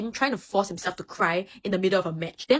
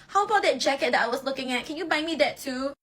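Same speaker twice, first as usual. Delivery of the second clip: whiny ask; 7 semitones higher than usual. Retold or imitated. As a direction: push your luck sweetly. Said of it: "Until Oikawa is like".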